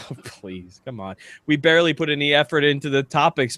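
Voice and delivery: ordinary talk, nothing marked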